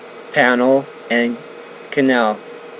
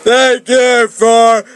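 higher, louder admiring thanks; flirting compliment; 11 semitones higher, 6 dB louder than usual. Acted. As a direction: compliment her with a grin, lightly voiced.

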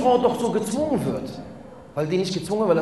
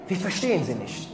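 much softer, lower repeat; hushed; 3 semitones lower, 7 dB quieter than usual. Acted. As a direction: drop it to a near-murmur. Preacher's loud then hushed repetition.

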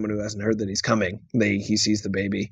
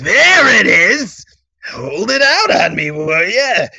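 gleeful Dafoe shriek; 13 semitones higher, 7 dB louder, much slower than usual. Retold or imitated. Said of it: "He's like".

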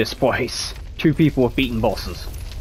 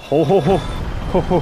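taunting ho ho ho; 6 semitones higher than usual. Pretend. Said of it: "we're gonna taunt the stairs".

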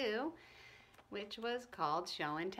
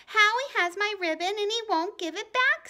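loud, high, upset complaint; angry, near tears; 10 semitones higher, 10 dB louder than usual. Acted. Ramona complains to the mothers, angry and near tears. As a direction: tattle loudly, close to crying. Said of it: "so angry she was near tears".

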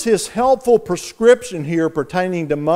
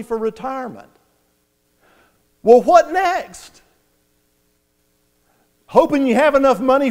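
higher and louder with every "what next"; building urgent back-and-forth; 5 semitones higher, 3 dB louder, faster than usual. Retold or imitated. Inner voice questioning the young man, ever more insistent. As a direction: press harder each round, pitch climbing. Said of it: "the voice says".

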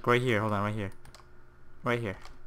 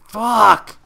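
sudden loud high curse; angry outburst; 7 semitones higher, 10 dB louder than usual. Real, not acted. Gamer yelling at a failed parkour jump, loud. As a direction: snap out the curse at the missed jump.

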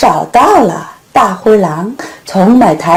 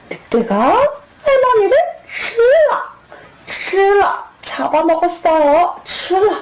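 dramatic gobbling; 9 semitones higher, faster than usual. Acted. Wolf devours grandma.

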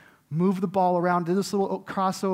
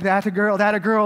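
proud cheer; 6 dB louder than usual. Retold or imitated. Proud dad praising daughter.

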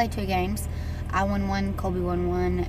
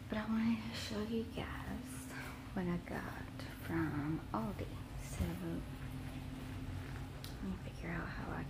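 soft hushed whisper so no one wakes; hushed late-night whisper to the camera; 13 dB quieter than usual. Real, not acted. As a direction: whisper it, the house is asleep. Late night, others asleep at home.

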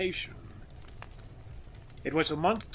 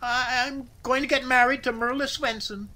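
high, louder nervous outburst; blurted confession; 9 semitones higher, 5 dB louder than usual. A narrator's reading. Worker confesses marriage plan to boss, nervous blurt. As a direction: let it burst out after stalling. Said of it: "it came, and with a rush".

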